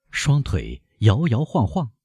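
plain reading voice

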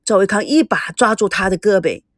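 ordinary delivery

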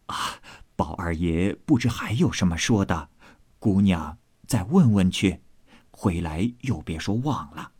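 put-on low, obliging old nanny; old servant woman. Old nanny accepting an errand, low and obliging.

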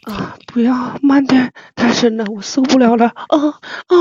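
put-on pleading moans between short phrases; hammed-up breathless pleading; mock moaning. Streamer doing a forfeit for co-hosts, mock moaning. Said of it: "太牛逼了".

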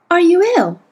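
put-on high, loud boy's question; anxious boy. A narrator's reading. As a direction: ask with a boy's worry. Boy Will asks.

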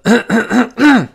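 loud throat clearing demo. Shown as a demonstration. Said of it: "我清了一下嗓子".

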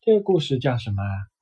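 plain reading voice

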